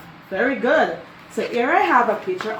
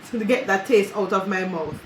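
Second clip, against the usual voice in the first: ordinary talk, nothing marked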